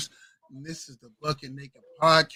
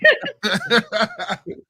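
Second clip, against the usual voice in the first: laughing; 7 semitones higher, 5 dB louder than usual. Real, not acted. Laughs at own joke.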